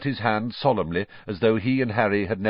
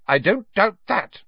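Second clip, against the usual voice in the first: high, louder motherly reproof; sudden disapproval; 12 semitones higher, 6 dB louder than usual. A narrator's reading. Mother frowning suddenly at Fred, disapproving. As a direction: pointedly put him down. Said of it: "frowning suddenly".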